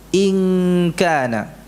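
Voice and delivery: "In kana" with the n hidden in a nasal hum before k; ikhfa nasal. Shown as a demonstration. Teacher showing ikhfa to the congregation, nasal hum. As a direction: let "In" fade to a hum into "kana". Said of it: "Demikian kita mengucapkan nun sukun yang dibaca dengan ikhfa".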